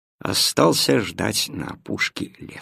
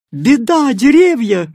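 high, loud alarmed cry; breathless shout; 11 semitones higher, 9 dB louder than usual. Acted. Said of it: "крикнула запыхавшаяся кошка".

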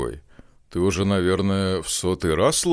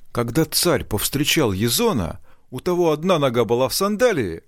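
rising higher and louder, speeding up; building excitement; 7 semitones higher, 3 dB louder than usual. Acted. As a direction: build suspense to the reveal. Storyteller builds to the twist.

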